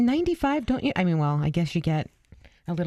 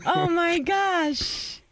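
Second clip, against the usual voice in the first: drawn out slow, high "oh my gosh"; mock despair at him starting over; exasperated; 12 semitones higher, much slower than usual. Real, not acted. Exasperated at restart.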